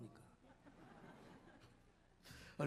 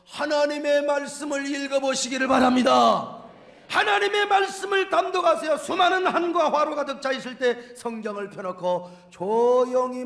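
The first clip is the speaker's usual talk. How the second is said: loud, raised exhortation to the congregation; full-force preaching; 3 semitones higher, 9 dB louder than usual. Real, not acted. Preacher urging the congregation to read scripture, full-force preaching.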